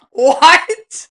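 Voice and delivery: high, loud incredulous what; shocked what. Retold or imitated. Friends hearing the story, loud shocked question. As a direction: throw it out in disbelief.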